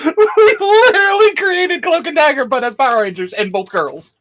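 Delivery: high-pitched squeal through real laughter; helpless laughter. Real, not acted. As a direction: barely get the words out for laughing. Cracking up at accidental duplicate creation.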